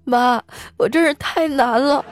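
high, slow, hammed-up whimper; mock choked-up. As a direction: whine to mum as if choking back tears. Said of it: "然后哽咽着说". (假装哽咽，拖慢声音向妈妈诉苦)

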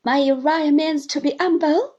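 high, loud plea; mother pleading. Acted. Mother pleads for Uriah, high and loud. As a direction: beg for her son. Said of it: "cried his mother".

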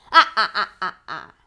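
loud, booming ha ha ha; big belly laugh of a giant; giant's mocking laugh. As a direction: laugh like a scornful monster. Cyclops laughs at Zeus.

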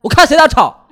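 loud, high yell at the whole carriage; furious shout. Retold or imitated. Fed-up passenger shouts down the rowdy train car. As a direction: roar to shut everyone up at once. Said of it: "我说".

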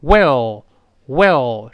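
well, drawn out and falling; well, said slowly with the tongue tip pushed up; well, a slow teaching demo with a falling tune; slow model well. Shown as a demonstration. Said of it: "是这么一个声音啊".

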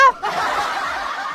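laugh. Real, not acted. Laughs at the punchline.

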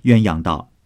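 usual speaking voice

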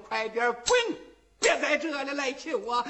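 acted irritated order; angry call. A narrator's reading. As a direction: send him packing crossly. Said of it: "喊声".

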